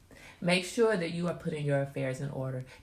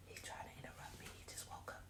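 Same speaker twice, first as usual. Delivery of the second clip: whispering; 22 dB quieter, faster than usual. Real, not acted. Whispering aside while son wakes.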